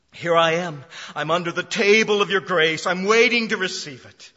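loud, earnest pulpit cry; loud preaching. Acted. Pastor voicing the woman's plea in loud preaching. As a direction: proclaim it loudly to the room.

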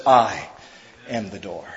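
very low and soft, almost spoken under breath; hushed. Retold or imitated. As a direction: drop to a near-hush on "am the door". Jesus points to himself.

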